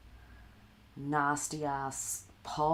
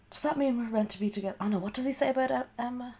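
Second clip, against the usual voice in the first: excited; 9 semitones higher, 4 dB louder, faster than usual. Real, not acted. Reading chat excitedly.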